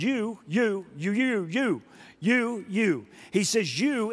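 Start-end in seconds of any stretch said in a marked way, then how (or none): 0.0-3.1 each YOU pitched up and punched; insistent, repeated stress on YOU; raised, emphatic YOU. Retold or imitated. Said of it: "he raised his voice and said". 3.8-4.1 YOU pitched high and stressed; YOU singled out with a raised pitch; emphatic YOU. Retold or imitated. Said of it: "in an emphatic way".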